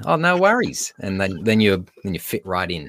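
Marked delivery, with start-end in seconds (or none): 0.1-0.9 a bright, much higher "Oh, no worries." falling off "worries"; casual Aussie. Shown as a demonstration. Teacher showing how to fit in, higher and breezy Australian. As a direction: toss it off lightly, pitched way up. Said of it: "there's a little question tone at the end".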